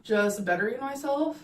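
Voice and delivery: usual speaking voice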